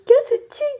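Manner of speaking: high, loud tearful disbelief; shocked disbelief. Retold or imitated. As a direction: squeal it like you can't believe it. Crying woman asks in disbelief. (ऊँची, ज़ोरदार हैरानी)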